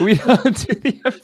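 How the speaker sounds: laughing. Real, not acted. Co-host repeating the camp joke, laughing and high. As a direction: echo the joke while cracking up.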